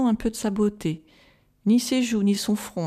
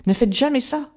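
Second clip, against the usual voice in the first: higher and louder, pleading warning; urgent cry; 6 semitones higher, 4 dB louder than usual. Acted. Van Helsing warning Arthur never to kiss her, urgent cry. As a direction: hurl the warning at him. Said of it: "s'écria-t-il".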